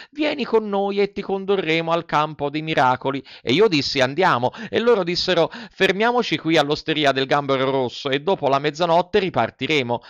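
lightly voiced puppet boy storyteller; chattering Pinocchio. Puppet tells the fairy about the assassins, chattering.

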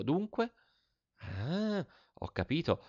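softly working it out, alone; soft private musing as it dawns; thinking to oneself. A narrator's reading. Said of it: "pensavo fra me". (pensando fra sé, a bassa voce)

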